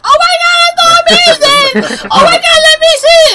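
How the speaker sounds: very high, loud, squealing through laughter; imitating a gushing lady. Older lady from the line rushing to see the ring, mock squealing. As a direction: gush like an overjoyed stranger. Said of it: "the lady, she's like".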